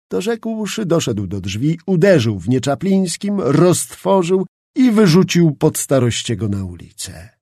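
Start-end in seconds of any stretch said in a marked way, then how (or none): none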